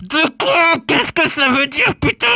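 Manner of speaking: high, drawn-out outburst of bafflement; comic exasperation. Acted. Host baffled by an old expression, high exasperated cry. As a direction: cry it out high, utterly fed up.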